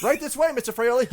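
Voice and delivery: high, polite usher voice; put-on handler. Handler leading the autographing star away, high put-on voice. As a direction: usher the star along in a lifted voice. Acts a handler summoning the guest.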